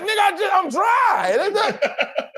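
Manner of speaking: high, slow laughing protest. Retold or imitated. Actor retelling his reply to the gangster coach, laughing protest.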